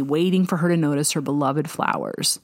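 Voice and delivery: ordinary delivery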